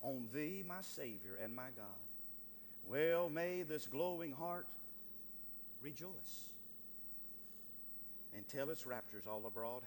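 measured, soft recital of hymn lines; hymn recitation. Acted. Preacher recalling a hymn to the congregation, reverent recitation.

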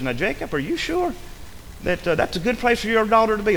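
high, soft questioning of a worried onlooker; concerned questioning. Acted. Someone questioning Jacob about his daughter, concerned and high. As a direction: gently query him, pitch rising.

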